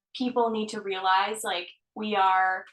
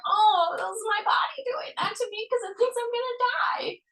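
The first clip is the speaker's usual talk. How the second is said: much higher, put-on voice; mock dramatic realisation; 12 semitones higher than usual. Coach retells catching body's self-sabotage, high mock drama. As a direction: play it up in a high dramatic pitch.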